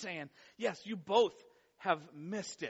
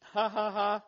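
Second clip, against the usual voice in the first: mock taunting laugh; 2 semitones higher, 8 dB louder than usual. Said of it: "you stood behind mom and were like".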